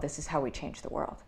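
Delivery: soft, earnest repeat of the slogan; quiet emphasis. Shown as a demonstration. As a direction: drop your voice and let it land. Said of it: "when I say".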